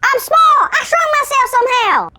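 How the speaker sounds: high, shouted elf squeak; cartoon elf. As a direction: shout it in a tiny panicked voice. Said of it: "sound like a cartoon elf".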